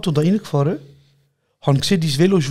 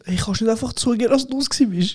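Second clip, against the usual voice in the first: accusing janitor; 6 semitones higher than usual. Retold or imitated. Janitor's accusing quote retold.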